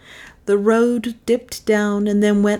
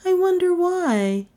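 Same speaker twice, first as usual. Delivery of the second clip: high, coy woman's voice; coy Melissa; 13 semitones higher than usual. Acted. Passenger teasing her admirer, coy and high. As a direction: wonder aloud in a high, coy voice.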